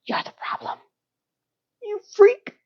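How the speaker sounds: hushed jab, then a high squeal of insult; offended. Real, not acted. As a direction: whisper the first, shriek the second. Personally offended by cat insult.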